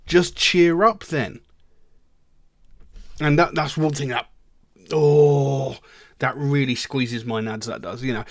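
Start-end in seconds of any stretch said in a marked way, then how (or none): 0.0-1.4 dismissive advice. Acted. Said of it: "they'll probably turn around and go". 4.9-5.8 loud, drawn-out "oh" of irritation; long aggravated groan; exasperated groan. Real, not acted. Exasperated at glib advice.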